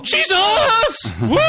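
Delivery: high, loud laughing whoop; excited whoop. Real, not acted. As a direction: cheer out loud with delight. Laughing cheer at the movie.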